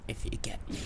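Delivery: unvoiced whisper; whispered aside. Real, not acted. Telling viewers a secret, whispered. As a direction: lean in and whisper it.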